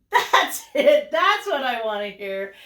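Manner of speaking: loud, delighted approval with a laugh; pleased, punchy encouragement; excited praise. Real, not acted. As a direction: cheer the pupil on. Cheering on the child's louder try.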